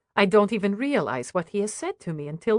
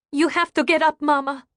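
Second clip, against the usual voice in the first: put-on higher, louder urgent child voice; a girl begging her mother to stand; pleading child; 7 semitones higher, 4 dB louder than usual. A narrator's reading. Daughter tugging at her mother, urgent and higher. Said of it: "she says".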